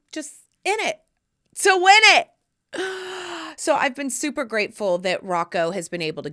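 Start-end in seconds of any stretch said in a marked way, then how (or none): 0.1-2.3 high and loud, in it to win it; sing-song the catchphrase with a punch; playful catchphrase. Acted. Catchphrase said with flair. 2.7-3.5 mock gasp. Gasp after catchphrase.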